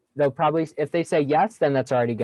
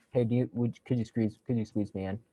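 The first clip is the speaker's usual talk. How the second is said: dull, softer, trailing ask; boring; 2 semitones lower, 7 dB quieter than usual. Shown as a demonstration. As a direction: mumble the request with no life. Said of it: "you're very boring".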